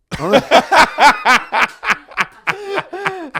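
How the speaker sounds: long loud high laugh; loud laughter. Real, not acted. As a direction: laugh out loud at your own joke. Laughs at own joke.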